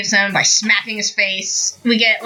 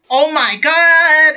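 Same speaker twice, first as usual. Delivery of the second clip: mock awe; 6 semitones higher, 3 dB louder, much slower than usual. Deku facing a giant robot.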